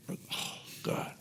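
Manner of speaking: barely voiced sigh to God; whispered groan. Man recalling the buyer never came, whispering. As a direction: let it out as a defeated whisper.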